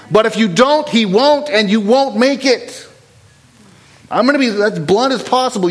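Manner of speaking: high, forceful warning; heated and blunt. Real, not acted. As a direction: preach it bluntly, with rising heat. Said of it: "got a little heated there".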